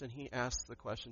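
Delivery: ordinary delivery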